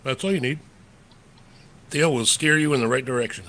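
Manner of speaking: ordinary talk, nothing marked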